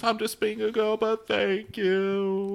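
high weepy imitation, slowing on the thanks; put-on crying falsetto, last words drawn out; mock tearful. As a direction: squeak it gratefully through pretend tears.

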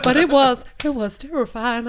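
ordinary talk, nothing marked

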